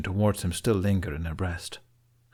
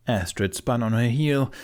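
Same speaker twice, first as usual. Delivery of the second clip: ordinary delivery, nothing marked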